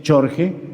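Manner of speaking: "Jorge" with a Y-like J at the start; mock mispronunciation. Shown as a demonstration. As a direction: say "Jorge" as YOR-heh. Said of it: "sino Jorge sería George".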